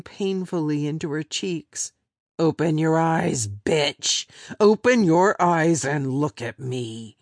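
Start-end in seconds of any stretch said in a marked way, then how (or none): 2.4-7.2 loud, harsh barked command; angry shout. Acted. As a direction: shout it down at her. Captor shouting at victim.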